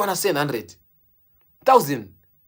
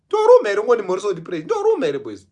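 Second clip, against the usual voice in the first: mock loud exclamation; 4 semitones higher, 8 dB louder than usual.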